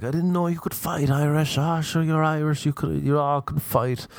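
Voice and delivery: high, knowing mock assurance. Voices people assuming Irish fight.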